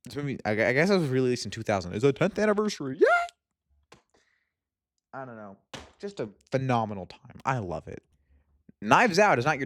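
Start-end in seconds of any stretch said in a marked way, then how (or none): 3.0-4.0 very high, excited whoop; cheer. Real, not acted. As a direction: throw out a joyful shriek. Celebrating tenth anniversary.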